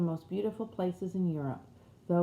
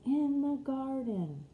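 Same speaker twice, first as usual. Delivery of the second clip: much higher and slow, sweet and flowing; like a golden flute; 7 semitones higher, slower than usual. Acted. Wife's dream voice answering the husband, high, slow and sweet. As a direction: sing it out like a flute. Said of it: "like a sound from a golden flute".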